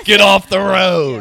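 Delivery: loud, slow New Jersey yell; New Jersey accent. Pretend. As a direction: bellow it like an angry Jersey driver. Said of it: "That's my New Jersey accent".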